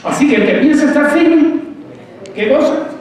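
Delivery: high, loud call to the flock; rally the congregation with a high, loud question; preaching cry. Acted.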